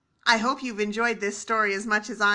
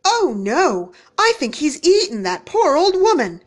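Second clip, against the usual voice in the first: alarmed woodcutter; 4 semitones higher, 7 dB louder than usual. A narrator's reading.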